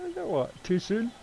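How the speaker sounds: high-pitched, breaking up with laughter; real giggly, high delivery; laughing. Real, not acted. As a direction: squeeze the words out mid-laugh. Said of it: "don't know why I'm laughing".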